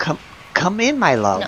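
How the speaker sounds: put-on high old lady voice; a coaxing old granny at the door. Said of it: "And says".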